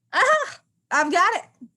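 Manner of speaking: high loud triumphant aha; eureka. Acted. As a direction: shout out the discovery. Sudden realization.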